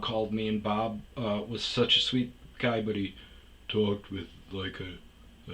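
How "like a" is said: ordinary talk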